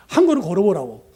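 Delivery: urging. Retold or imitated. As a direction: push him to take the step.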